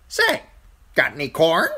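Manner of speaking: high, loud bird voice; pert crow. A narrator's reading. Crow asking falling Bran for corn, pert and high. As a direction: pipe up like a cheeky bird.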